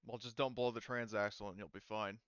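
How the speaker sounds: usual speaking voice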